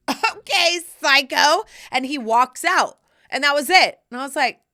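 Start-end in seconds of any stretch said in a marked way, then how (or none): 0.1-1.6 high, loud incredulous comeback; taken-aback, pitched-up retort; baffled reaction. Acted. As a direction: throw it out loud in mock disbelief. Acted baffled reaction to curt answer.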